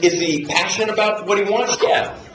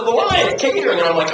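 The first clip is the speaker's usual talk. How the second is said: ordinary delivery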